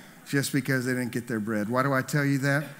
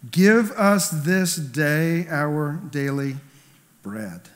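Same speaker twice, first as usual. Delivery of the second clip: slow prayer recitation; 3 dB louder, much slower than usual. Acted. Preacher reciting the prayer line, slow and reverent. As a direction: stretch each word like a prayer.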